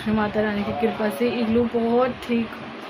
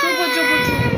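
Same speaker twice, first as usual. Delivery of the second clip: hushing; 14 semitones higher, much faster than usual. Real, not acted. Soothing a crying child.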